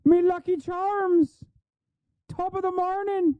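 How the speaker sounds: high, slow, soft parody of a folksy Michigander turned leprechaun; exaggerated mocking folksy voice; mock Yooper leprechaun. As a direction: ham it up as a fake Midwest accent sliding into Irish. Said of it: "I'm a leprechaun now".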